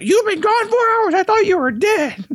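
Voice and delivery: high-pitched squeal; high squeaky coworker. Retold or imitated. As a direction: shriek it up high. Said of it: "the chick is like".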